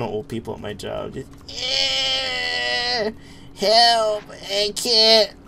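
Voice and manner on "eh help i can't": put-on high, creaky old-timer plea; very old faint voice. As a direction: play a feeble old customer. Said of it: "this old person voice".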